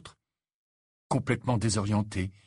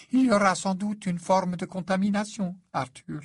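lightly voiced character, pitched up; grave explanation; 10 semitones higher, 3 dB louder than usual. A narrator's reading. Lupin telling a father about possible contamination, grave. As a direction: explain it carefully in a higher voice.

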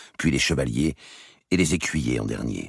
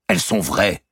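stubborn repeat; 6 semitones higher, 6 dB louder than usual. Acted. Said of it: "répéta Robert".

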